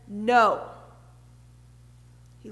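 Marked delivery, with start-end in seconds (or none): 0.1-0.7 a firm, louder, lower "No."; emphatic no. Retold or imitated. Jesus refusing the crowd's logic, firm and louder. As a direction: plant the "No." like a verdict. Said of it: "Jesus emphatically says".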